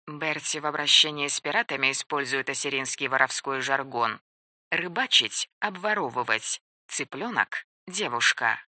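soft, hushed explanatory aside; quiet aside. A narrator's reading. Aside glossing thieves' slang.

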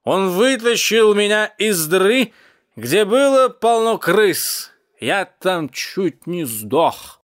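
put-on high, loud, drawn-out drunken lament; impassioned cat. A narrator's reading. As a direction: wail it slowly at full voice. Cat rants drunkenly about rescue.

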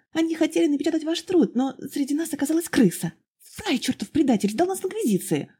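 put-on high, eager young woman pouring out her story; young girl's voice. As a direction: pitch it up high, breathless and earnest. (высокий взволнованный девичий голос)

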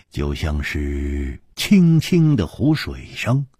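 plain reading voice

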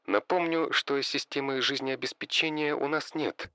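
deadpan assistant, coolly warning. Acted. AI voice answers. (тихий сухой голос ИИ)

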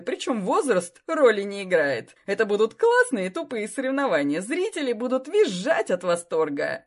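loud, brash businessman voice; pushy sponsor. Acted. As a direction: boom out the idea eagerly. Sausage king pitches his idea.

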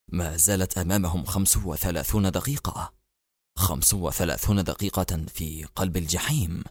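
low, soft murmur to himself; muttering. A narrator's reading. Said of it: "وهو يغمغم".

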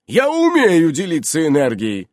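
loud, high self-justification; offended Innokenty. A narrator's reading. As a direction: insist on your abilities with wounded pride.

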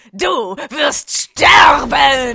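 slow, loud spell-shouting; hammed-up duel cries; battle shout. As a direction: yell it like a game attack. Video game battle spells.

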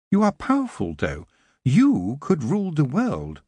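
lightly voiced aloof elf, questioning; cold elf. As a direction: elf questioning Tiffany, cool and detached. Nightshade the elf.

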